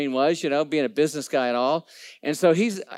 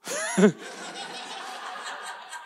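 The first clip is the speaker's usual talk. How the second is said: breathy laugh; 4 semitones higher, 5 dB quieter than usual. Real, not acted. Laughing at the reply.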